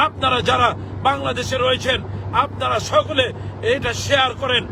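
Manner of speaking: ordinary talk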